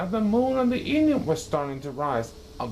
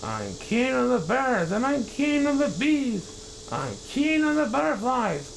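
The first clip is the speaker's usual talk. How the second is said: triumphant king; 11 semitones higher than usual. Acted. Yertle boasting from his high throne, high and gloating. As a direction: crow grandly over the sky.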